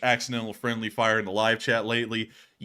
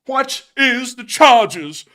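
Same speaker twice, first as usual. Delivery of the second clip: high, loud, slow outraged gentleman; indignant meme voice; 11 semitones higher, 10 dB louder, much slower than usual. Pretend. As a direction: huff like a scandalized aristocrat. Succulent Chinese meal meme.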